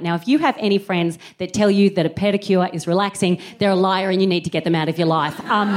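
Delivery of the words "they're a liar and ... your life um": ordinary talk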